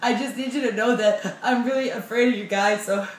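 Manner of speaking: high, loud, tearful pleading; panicked patient begging the dentist; stuttering in tears. Retold or imitated. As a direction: blurt it out scared and crying. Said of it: "I'm stuttering at him".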